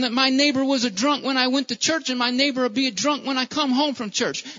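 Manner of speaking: loud, high, driving preacher's cry; fiery pulpit delivery; preaching at full force. Acted. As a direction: preach it out to the whole room, full voice.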